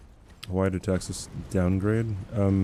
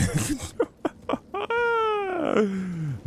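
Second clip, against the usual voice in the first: high-pitched drawn-out laugh; real high laugh trailing out long. Real, not acted. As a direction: burst out in a high laugh after a scare. Laughing after a scare.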